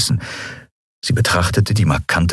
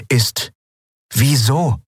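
wieso asked higher and pressing, rising hard at the end; serious, demanding; 7 semitones higher, slower than usual. Acted. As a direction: demand wieso with a steady look. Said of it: "ernst, fordernd".

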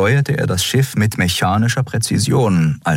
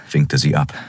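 usual speaking voice

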